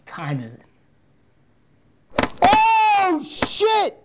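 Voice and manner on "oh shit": high, sudden yelp of fright; real jump-scare shriek; startled yelp. Real, not acted. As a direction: blurt it out as something jumps at you.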